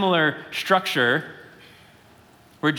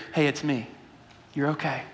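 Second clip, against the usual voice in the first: softer, lower and gentle, calming the frightened; reassuring; 4 semitones lower, 6 dB quieter, faster than usual. Acted. Jesus calming the frightened disciples in the boat, soft reassurance. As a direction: soothe them like a friend in a storm. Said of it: "He reassures them in the midst".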